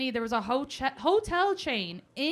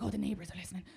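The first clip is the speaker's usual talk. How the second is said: low, very soft, wary undertone; hushed Irish talk; 4 semitones lower, 8 dB quieter, faster than usual. Retold or imitated. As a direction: imitate keeping it down for the neighbours. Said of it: "Irish people talk like this".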